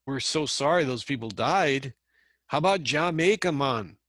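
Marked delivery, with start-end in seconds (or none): 2.5-4.0 playful mock Jamaican accent. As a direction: toss off a breezy Jamaican "Jamaica, man". Mimicking Jamaican tourist slogan.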